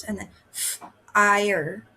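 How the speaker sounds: teacher segmenting fire for the class; sounds segmented. Shown as a demonstration. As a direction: fire split into f and ire. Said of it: "That time it had four sounds".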